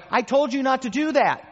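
high, loud exasperated scolding; frustrated parent. Retold or imitated. Parent scolding a disobedient child, high and exasperated. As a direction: snap at the kid who won't listen.

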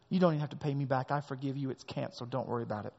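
soft, falling, reassuring release; gentle master forgiving. Acted. Master gently forgiving the begging servant, soft and reassuring. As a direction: let him off tenderly.

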